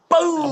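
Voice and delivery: high-pitched, drawn-out "boom"; imitated gunshot boom. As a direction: mimic the gun going off.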